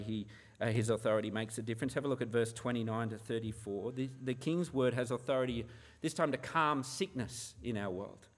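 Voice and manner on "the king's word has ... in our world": normal talking voice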